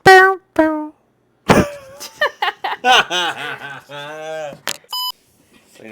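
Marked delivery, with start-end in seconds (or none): 0.0-0.9 1 shouted bow bow. Pretend. Goofy shouted sound effect.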